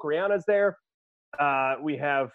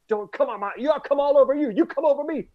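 mock freakout; 10 semitones higher, 3 dB louder than usual. Danny McBride losing it, quoted.

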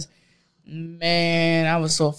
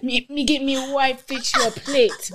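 high, playful Caribbean host voice; mock Caribbean accent; 11 semitones higher than usual. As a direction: offer the plate in a hammed-up island accent. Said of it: "I'm like".

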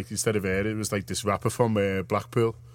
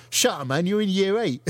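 mock Cockney grime diss, pitched up; Cockney accent; 9 semitones higher than usual. Quoting a grime diss to mates, in a Cockney accent. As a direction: sneer it like a cheeky London rapper. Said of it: "I just do grime in a Cockney accent".

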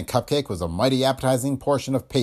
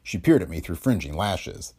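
ordinary delivery

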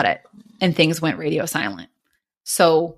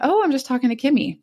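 higher, airy retold reply; feigned casualness; 7 semitones higher than usual. Telling fiancé who she is talking to, casually high. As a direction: toss it off lightly, higher pitched.

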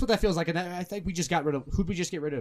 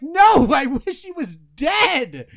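high, loud, stretched-out wail of outrage; hammed-up fury; 9 semitones higher, 9 dB louder, much slower than usual. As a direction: yell it slowly in mock rage. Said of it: "I was so mad".

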